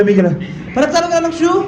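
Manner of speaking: ordinary talk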